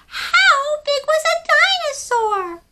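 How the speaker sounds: high, loud curious little penguin voice; a tiny penguin full of questions. Acted. Story reader voicing small penguin Pipkin asking big questions. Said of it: "the thing he wanted to know".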